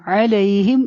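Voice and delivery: علیہم with throaty ع and a soft lay glide. Shown as a demonstration. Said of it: "لئی کو نرمی سے پڑھنا ہے". (علیہم میں لئی نرمی سے)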